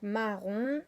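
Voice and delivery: slow "marron", ma and ron held apart; questioning rise on a drawn-out "marron"; syllable by syllable. Shown as a demonstration. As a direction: stretch "marron", lifting the last syllable. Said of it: "dans la première ou dans la deuxième".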